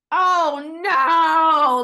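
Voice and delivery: loud, high stunned cry; mock-astonished outcry. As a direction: shock at an answered prayer. Reacting to free studio offer.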